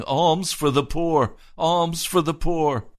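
high, pleading call of a beggar, "Alms for the poor"; lightly voiced beggar calling out for coins; beggar's plea. Blind beggar calling to passersby, a high pleading call.

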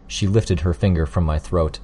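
usual speaking voice

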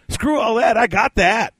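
high, brash brush-off; retold drunken boast. Friend dismissing the cost worries, mock brash. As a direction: imitate a friend waving away objections.

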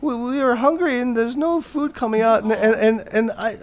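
put-on high, soft old-lady voice; high-pitched put-on granny voice. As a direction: imitate an elderly diner apologetically explaining. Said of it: "And this old lady".

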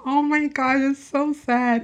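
mock-tearful, high and slow on so sad; teary reaction. Retelling her teary reaction to the movie, high and drawn out. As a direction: imitate herself welling up in the theater. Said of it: "I was just like".

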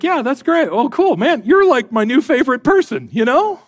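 high, excited mock gushing; gushing delight. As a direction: gush over your new favorite person, pitch bouncing up. Acts out delight at his benefactor.

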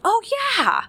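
put-on high, loud child voice; a mom mimicking her thrilled son; son's excited reply. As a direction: chirp it like an excited little boy. Son reacting, mother voicing him.

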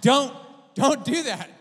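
high, loud, slow mock plea; alarmed warning. Preacher warns his healed brother jumping up and down, high and loud. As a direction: call out in exaggerated alarm.

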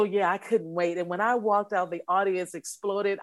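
normal talking voice

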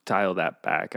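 ordinary talk, nothing marked

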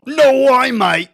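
high, loud Aussie outburst; exaggerated Australian mateyness; Australian accent. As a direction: blurt it in a hammed-up Australian accent. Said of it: "does the best Australian accent".

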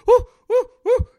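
very high, loud yelps; yelping. Retold or imitated. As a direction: yelp from the cold water. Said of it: "I'm yelping".

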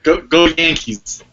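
mock Boston accent cheer. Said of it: "that was more of a Boston accent".

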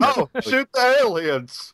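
high, slow, dopey mock excitement; mock gamer glee. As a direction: play the clueless player thrilled by aliens. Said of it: "I was like".

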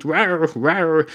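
lion rawr. Retold or imitated. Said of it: "came in there and went".

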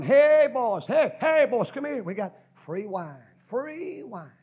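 high, loud hollering to men down the street; preacher yelling out of a truck to the winos; calling out. Retold or imitated. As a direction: holler hey boys across the alley. Calling out to winos on Skid Row.

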